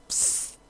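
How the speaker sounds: spray sound. Retold or imitated. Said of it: "and she goes".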